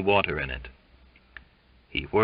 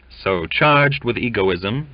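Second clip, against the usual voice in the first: plain reading voice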